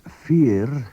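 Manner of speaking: fear in the Northern Manx way; Northern Manx form. Shown as a demonstration. Teacher to learners, the Northern Manx fear. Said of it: "as they would say in Northern Manx".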